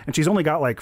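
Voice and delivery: ordinary delivery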